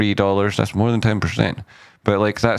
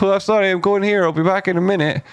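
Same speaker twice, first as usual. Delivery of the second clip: high-pitched, put-on excuse; mock apology; 11 semitones higher, faster than usual. Trader voicing a price move leaving, mock apologetic. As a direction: pipe it up like a hurried apology.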